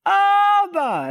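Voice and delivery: high call of Abba across the plane; child calling out. Retold or imitated. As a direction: cry out like a little boy for his dad. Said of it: "at the top of his lungs".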